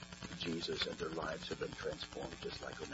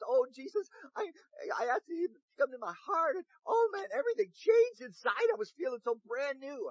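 put-on much higher, excited child's voice; gushing little boy; 7 dB louder than usual. Little Davey telling Mom what Jesus did, high gushing child voice. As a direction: bubble over like a six-year-old just saved. Six-year-old brother testifies.

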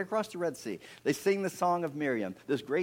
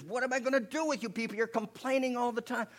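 high, fast outburst; upset, exasperated; 11 semitones higher than usual. Acted. Fed-up Moses to the complaining people, exasperated. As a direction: snap in acted exasperation, pitched right up. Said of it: "Moses gets upset".

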